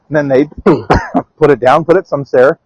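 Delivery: ordinary delivery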